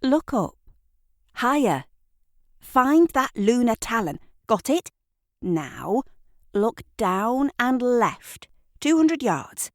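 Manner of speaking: high, bright directions, lingering on look down; guiding the listener. A narrator's reading. Narrator guiding the listener to the hidden building. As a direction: lead their eyes across the sky. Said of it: "narrator directs the listener's gaze".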